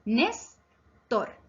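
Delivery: "Néstor" broken as Nés, pause, tor; teacher dividing "Néstor" into its syllables. Shown as a demonstration. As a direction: split "Néstor" into two separate beats. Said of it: "Se divide en dos sílabas".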